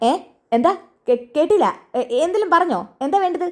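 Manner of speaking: high-pitched deaf tea seller asking again; deaf old shopkeeper. Acted. Deaf tea shop owner voiced. (ചെവി കേൾക്കാത്ത ചായക്കടക്കാരൻ്റെ ഉയർന്ന ശബ്ദം)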